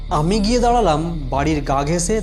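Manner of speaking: usual speaking voice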